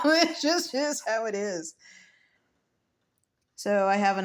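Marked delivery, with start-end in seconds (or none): 0.0-1.8 higher, through a real laugh. Real, not acted. Vlogger shrugs off how life works, laughing higher.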